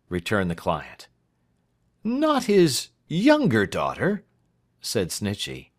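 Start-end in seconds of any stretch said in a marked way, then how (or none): 2.0-4.2 high, loud, drawn-out query; alarmed question. A narrator's reading. Lawyer dreading client loves the doctor's daughter, high alarmed question. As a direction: ask it slowly in raised disbelief.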